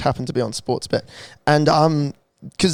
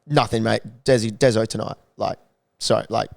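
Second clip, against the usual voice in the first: lower, softer, bored and casual to the bouncer; sound bored; 6 semitones lower, 5 dB quieter than usual. Shown as a demonstration. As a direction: shrug it off like it's nothing. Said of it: "sound bored".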